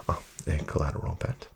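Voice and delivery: ordinary talk, nothing marked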